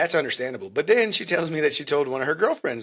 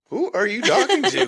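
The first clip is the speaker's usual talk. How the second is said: surprised laugh; 9 semitones higher, 6 dB louder than usual. Real, not acted. Hearing spouse whisper on phone.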